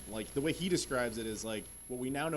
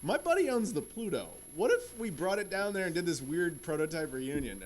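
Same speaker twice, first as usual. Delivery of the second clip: excited retold pitch; 5 semitones higher, 3 dB louder than usual. Retells his own excited pitch.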